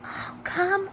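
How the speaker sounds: lightly voiced small anemone, hushed; soft whisper. A narrator's reading. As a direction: breathe it like a small sea creature. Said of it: "whispered a small sea anemone".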